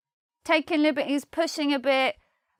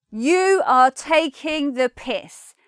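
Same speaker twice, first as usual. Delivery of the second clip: slow, louder You are taking the piss with YOU hit hard; stress on You; 3 semitones higher, 5 dB louder, slower than usual. Shown as a demonstration. Teacher showing a stronger accusing tone. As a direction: land You with heavy emphasis. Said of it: "you've done something really awful".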